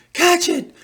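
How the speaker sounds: sudden high, loud sneeze; real sneeze. Real, not acted. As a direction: sneeze out the words explosively. Runny nose, apparent sneeze mid-sentence.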